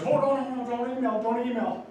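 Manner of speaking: high, loud, hurried "no no no"; excited refusal. Retold or imitated. Old friend waving off email, high and loud. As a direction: blurt the protest up high and loud.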